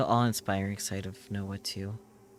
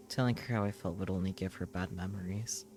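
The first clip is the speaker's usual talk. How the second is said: ordinary delivery, nothing marked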